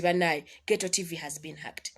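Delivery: hushed whisper. Retold or imitated. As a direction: whisper it like bad news. Quoting own announcement of hack.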